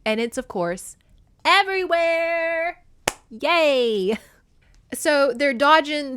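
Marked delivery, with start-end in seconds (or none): 1.4-2.8 slow, high stretched everywhere; drawn-out emphasis. Real, not acted. Exaggerating the deadly gold.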